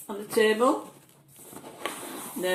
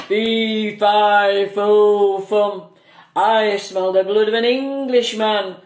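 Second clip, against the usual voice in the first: booming giant voice, much louder; big storybook giant bellowing his chant; giant's voice; 9 dB louder, slower than usual. A narrator's reading. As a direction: read aloud like a fearsome giant. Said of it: "said the giant".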